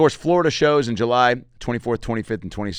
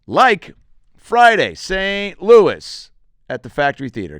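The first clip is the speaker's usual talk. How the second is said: hype announcer; 7 semitones higher, 8 dB louder, slower than usual. Acted. Hyped tour date announcement.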